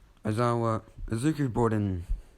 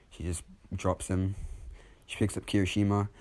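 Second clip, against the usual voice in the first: usual speaking voice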